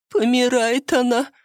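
high, tearful wail; sobbing. A narrator's reading. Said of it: "всхлипнула".

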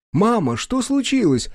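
put-on high, clear child's question; ringing child's voice. A narrator's reading. Daughter asks her stunned mother what happened, ringing. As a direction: ask like a worried little girl. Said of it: "звонко спросила Вася".

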